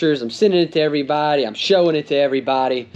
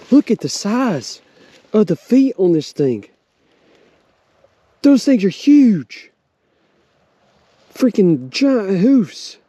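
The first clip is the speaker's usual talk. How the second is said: higher and louder, slowed in amazement; pumped-up hunter gushing over his deer; excited outburst; 6 semitones higher, 3 dB louder than usual. Real, not acted. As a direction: marvel out loud at the huge hooves. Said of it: "you know that I get excited".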